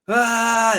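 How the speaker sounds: wide-mouthed shout from the chest; projected shout. Shown as a demonstration. Showing how the singer projects, loud open shout. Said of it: "opening his mouth really wide and projecting his vocals".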